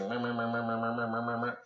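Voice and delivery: low, soft, monotone "meh" chant; unbothered little animal noise; imitated armadillo munching. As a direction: babble on quietly, oblivious. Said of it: "He's just like".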